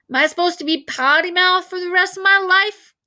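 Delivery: put-on kid brother protest. A narrator's reading. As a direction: pipe up like an indignant little boy.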